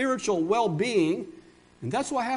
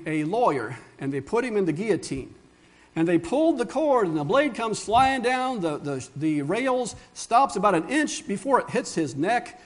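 ordinary talk

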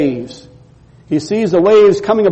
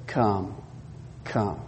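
very low, much softer beckoning; soft invitation; 11 semitones lower, 17 dB quieter than usual. Retold or imitated. Jesus softly inviting Peter to step out, very low and soft. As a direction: barely breathe the word out.

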